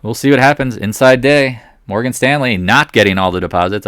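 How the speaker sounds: usual speaking voice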